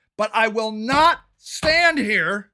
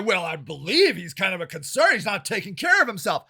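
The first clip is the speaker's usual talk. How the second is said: soft, lower mocking take on "not taking care of himself"; sneering at the bill's language, lower and softer; mock official concern; 7 semitones lower, 4 dB quieter than usual. Council speaker mocking the bill's wording, lower and softer. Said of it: "all that other bullshit in there".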